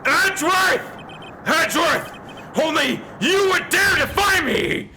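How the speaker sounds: enraged roar. Acted. Cornered prosecutor roars at Edgeworth, furious. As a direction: bellow in outrage at the one who defied him. Von Karma exposed, raging.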